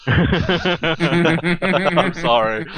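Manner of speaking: stretched out through a real laugh; laughing apology. Real, not acted. Laughing at his own crude joke about Mega Man. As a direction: gasp the apology out while still laughing.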